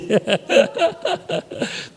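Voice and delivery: high-pitched real chuckling; amused laugh. Real, not acted. Preacher tickled by his own dialect quiz, high laughing. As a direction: laugh helplessly at your own joke.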